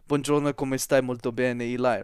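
practised, fluent Italian small talk; Italian pronunciation. Shown as a demonstration. Speaker shows off language switching, in fluent Italian. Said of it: "my pronunciation's perfect across all three".

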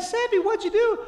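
retold dad's startled question. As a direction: question the toddler in high-pitched mock alarm. Dad confronting toddler about lipstick.

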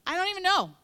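high, loud exasperated cry; overwhelmed. Retold or imitated. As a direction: throw up your hands in exasperation. Overwhelmed mom voiced in story.

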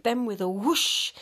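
ordinary delivery

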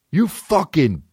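high-pitched mock yell of road rage; retold angry scream. Driver cursing at a pedestrian who smacked his car, retold yelling. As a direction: yell it high, re-enacting your outburst. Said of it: "I'm screaming at this guy".

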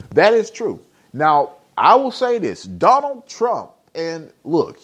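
high, loud preacher-style rant; fired-up rant. Acted. Impassioned rant about Trump.

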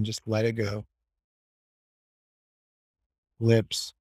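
low, even meditation voice with long pauses; guided meditation. Acted. Guiding the group to relax and release tension, calm meditation voice. Leads a guided body scan.

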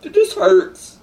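holding back sobs while speaking; crying. Real, not acted. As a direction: voice breaking high on hurts, tearful. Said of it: "I don't know why I'm crying".

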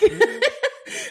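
real high giggle; cracking up high; high burst of laughter. Real, not acted. Laughs at own brain voice.

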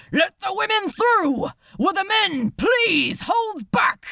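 high, loud officer's shout over panic; shouted command. A narrator's reading. Officer shouting orders to the crowd at the lifeboat.

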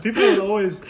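ordinary talk, nothing marked

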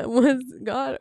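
giggling through a misquote; half-laughing, amused rising tone; laughing at the slip. Shown as a demonstration.

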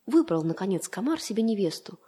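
ordinary delivery

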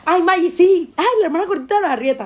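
high, loud singsong mockery of a cruel teaser; mocking taunt. Woman mimics the teasers who mocked her weight, in a high mocking voice.

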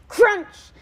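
high, loud burst on one word; exclaimed crash. A narrator's reading. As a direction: hit it like a sudden smash. Sound effect read out in story.

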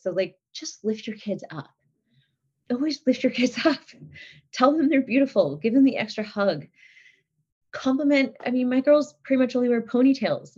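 usual speaking voice

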